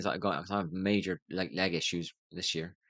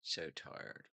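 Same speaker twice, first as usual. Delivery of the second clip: breathy whisper, much softer, drained; 4 semitones lower, 16 dB quieter than usual. Real, not acted. Alone on stream, exhausted, sighing whisper. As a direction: barely breathe it out, worn out. Yawning, complaining of tiredness.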